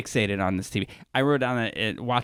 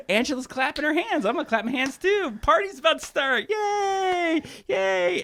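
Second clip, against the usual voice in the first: high-pitched imitation of naive glee; mock excited housemates; 13 semitones higher than usual. Housemates joining the clapping, mock party excitement. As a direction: cheer along in a silly high voice. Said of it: "people are like".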